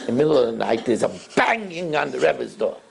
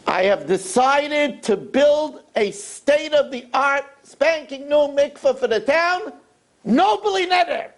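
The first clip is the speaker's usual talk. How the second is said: rich man's pompous announcement, slow and higher; grand announcement; 12 semitones higher, slower than usual. Acted. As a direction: announce it grandly to the whole shul. Rich man announces to town.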